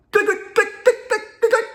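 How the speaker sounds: high-pitched string of gun clicks; imitated pistol clicks. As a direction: click away like a semi-automatic. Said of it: "is a pistol or a semi-automatic rifle".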